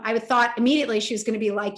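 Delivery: normal talking voice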